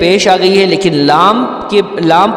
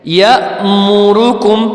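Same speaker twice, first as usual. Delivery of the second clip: slow, sung tajweed chanting; Quran recitation; slower than usual. Shown as a demonstration. Teacher chanting the verse for students, slow and melodic. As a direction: chant each word slowly with full melody. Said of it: "Quran recitation demo".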